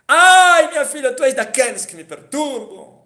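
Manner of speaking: high cry of a grieving father, fading; anguished cry. Acted. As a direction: wail it, then let it sink. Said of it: "E ele gritou". (grito de pai em aflição)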